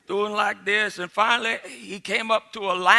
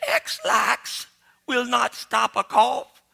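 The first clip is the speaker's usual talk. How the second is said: laughing; 6 semitones higher, slower than usual. Real, not acted. Manager line, teller laughing.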